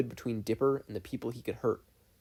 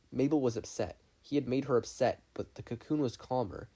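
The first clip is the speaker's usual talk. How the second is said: usual speaking voice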